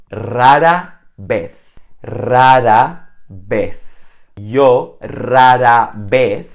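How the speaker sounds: rara vez slow and loud, stressing the r of rara; rolled r demo. Shown as a demonstration. Teacher showing learners the Spanish r, slow and loud. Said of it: "they don't know how to pronounce the R sound".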